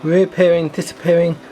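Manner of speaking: excited. Real, not acted. Carriages reappear again.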